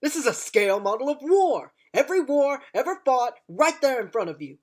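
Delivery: loud, impassioned speech building to a shout; booming rousing general speech. Acted. Doctor's rising speech about war.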